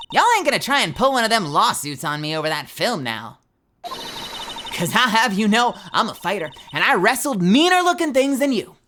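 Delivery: a hint of a Southern belle squaring up; amateur Southern twang; attempted Southern accent. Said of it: "I don't do accents, but I try".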